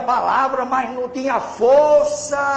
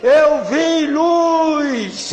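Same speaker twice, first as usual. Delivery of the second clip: imitated crying shout; 3 semitones higher, 3 dB louder than usual. Drunk stranger runs up to the seated preacher, wailing shout. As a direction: act out a man shouting through tears, stretching the key words. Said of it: "Aí o homem veio gritando, chorando".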